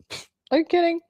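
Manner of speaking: high-pitched scoffing disbelief; incredulous scoff. Real, not acted. Answering whether media controls narratives, incredulous scoff.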